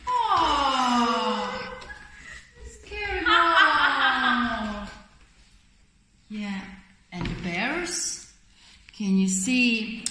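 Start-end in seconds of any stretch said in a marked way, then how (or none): 0.1-4.9 slow, hammed-up growl with a laugh; scary bear. Parent as the bear, playfully scaring the mole.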